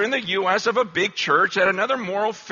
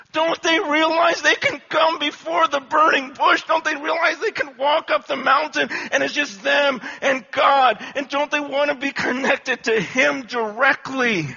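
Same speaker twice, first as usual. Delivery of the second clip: raised, urgent preacher's cry; impassioned preaching; 7 semitones higher, 4 dB louder than usual. Acted. Preacher urging his church to seek God himself, rising fervour. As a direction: press the congregation with rising fervour.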